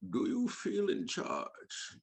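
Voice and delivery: slow, soft, high villain menace; unhurried theatrical villain voice; Bane. Acted. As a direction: quote the masked villain, drawn out and quiet. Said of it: "the way he talks with his eyes".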